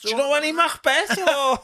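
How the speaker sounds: high, loud theatrical mock; teasing thespian impression; hammy actor voice. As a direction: play along with the old thespian. Host joins the actor impression.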